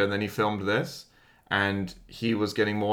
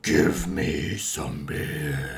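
slow, dragged-out zombie growl; werewolf voice; 5 semitones lower, much slower than usual. Pretend. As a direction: drag it out like a laughing zombie. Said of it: "you do it then".

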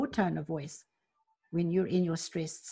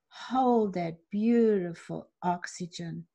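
ordinary talk

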